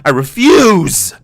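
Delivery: normal talking voice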